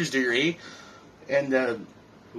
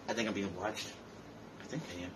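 drops to a much softer, lower murmur; paranoid hush; 7 semitones lower, 15 dB quieter, faster than usual. Acted. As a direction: fade away as if someone is listening. Fears he is being watched.

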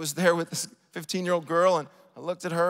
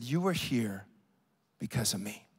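very low, soft, with a pause before "because of me"; hushed revelation; 9 semitones lower, 7 dB quieter than usual. Retold or imitated. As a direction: drop right down and let "because of me" land quietly. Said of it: "She said these words".

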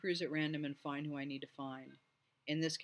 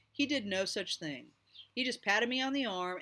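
objecting; 6 semitones higher, 6 dB louder than usual. A narrator's reading. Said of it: "I objected".

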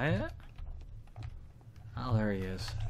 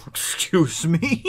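indignant excuse me; 6 semitones higher, 8 dB louder, much slower than usual. Real, not acted. Reacting to game character.

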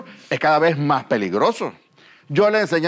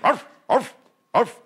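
short, high dog yaps; medium dog bark; 7 semitones higher, slower than usual. Acted. Comedian showing the audience different types of dogs, medium dog bark. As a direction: imitate a mid-sized dog's higher bark. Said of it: "El perro mediano".

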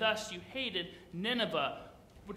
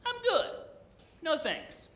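high, casual brush-off, much higher voice; mock casual no thanks; breezy refusal; 10 semitones higher than usual. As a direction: shrug it off lightly like Jonah. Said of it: "But Jonah says".

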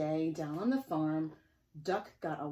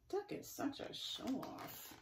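slow breathy whisper; whispered thought; 8 semitones higher, 8 dB quieter, slower than usual. Acted. Pigs secretly thinking about the duck, slow whisper. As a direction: whisper it behind his back.

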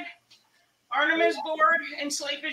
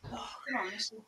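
whispered; 3 semitones lower, 13 dB quieter, faster than usual. Real, not acted. Encouraging during plank.

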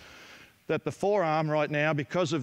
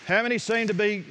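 raised, higher voice carrying over the court; projecting to the gym; 4 semitones higher than usual. Real, not acted. Coach calling to other coaches across a busy gym, raised projected voice. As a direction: call it out so the coaches hear over the balls.

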